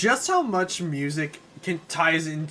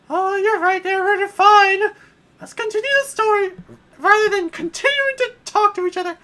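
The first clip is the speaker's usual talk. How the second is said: much higher, loud whiny princess; high falsetto princess complaining; 7 dB louder than usual. Acted. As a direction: squeal like a spoiled princess. Peach voice.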